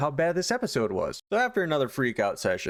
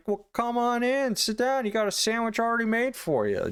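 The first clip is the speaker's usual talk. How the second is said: high, snide put-on welcome; mock-hospitable sarcasm; 12 semitones higher than usual. Husband sarcastically invites in the bulldog, being nasty. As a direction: imitate a jerk playing gracious host. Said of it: "he's just being a real dick".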